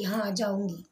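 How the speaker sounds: put-on a shy girl's soft, slow reply; a frightened girl quietly answering her kind teacher; slow and timid. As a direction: barely get the words out, slowly and softly. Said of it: "तो वो धीरे धीरे बोली".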